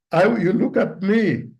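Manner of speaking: usual speaking voice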